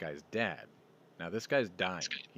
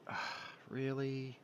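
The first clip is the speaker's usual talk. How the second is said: mock disbelief; 7 semitones higher than usual. Friend reacting to a picture of her carry gun, incredulous. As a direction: act out exasperated disbelief, pitched well up.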